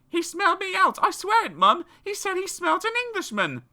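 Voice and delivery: put-on high boy's voice; a boy swearing he's telling the truth; Jack insisting. A narrator's reading.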